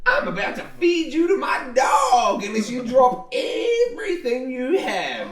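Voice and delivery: loud menacing thug, half laughing; threatening villain. Acted. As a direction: bark the threat like a cocky bandit. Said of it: "and says".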